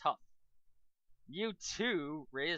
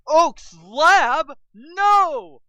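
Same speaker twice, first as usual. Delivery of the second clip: much higher, loud cry of disbelief; shocked outcry; 20 dB louder, much slower than usual. Acted. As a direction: scream it in horror. Jake shocked by news.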